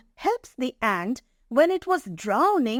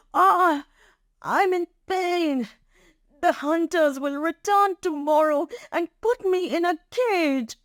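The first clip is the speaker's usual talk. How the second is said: higher-pitched cry for help; distressed lion; 7 semitones higher than usual. A narrator's reading. Trapped lion crying out about the hunters, distressed. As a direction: wail in pain from the net. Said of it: "The lion roars for help".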